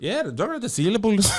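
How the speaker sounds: high, lilting Irish accent; sing-song Irish accent. Shown as a demonstration. Answering a co-host's critique in sing-song Irish accent. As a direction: sing the words in a playful Irish lilt. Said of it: "you have the sing-songiness".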